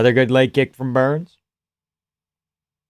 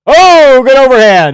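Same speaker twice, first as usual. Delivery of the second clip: much higher, loud burst at the punch; excited shout; 11 semitones higher, 12 dB louder than usual. Real, not acted. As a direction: yell it as the punch lands. Reacting to big punch.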